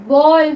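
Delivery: बॉल with an open aw, not baal; ball with aw. Shown as a demonstration. Teacher modelling the right word for students, loud and clear. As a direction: say बॉल with a rounded open aw vowel. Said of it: "बाल तो पढ़ते नहीं है".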